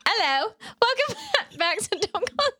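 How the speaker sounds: put-on high English accent collapsing into laughter; badly faked English welcome, giggling. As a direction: try an English accent and crack up. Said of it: "I wanna learn an English accent".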